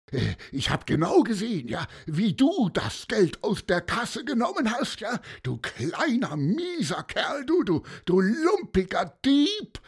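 high-pitched, menacing accusation; worked-up, hectoring name-calling; threatening. A narrator's reading. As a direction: berate the boy, stuttering with indignation. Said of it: "sagte Herbert Bohnemann drohend".

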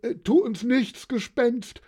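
high, pleading cry; frightened call. A narrator's reading. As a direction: beg the ghost for mercy. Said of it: "riefen sie".

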